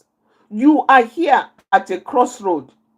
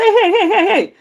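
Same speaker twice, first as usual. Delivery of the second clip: raised, laughing hey to settle someone; calming call; 8 semitones higher than usual. Shown as a demonstration. Counsellor showing how to hail an angry person, light laughing call.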